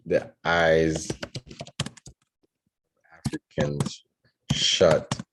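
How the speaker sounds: word-by-word story composing, long pauses; slow dictation. Real, not acted. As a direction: dictate it haltingly, as if writing along.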